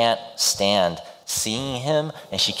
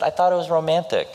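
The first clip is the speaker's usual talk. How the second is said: sheepish hero; 9 semitones higher, 4 dB louder, faster than usual. Retold or imitated. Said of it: "He said".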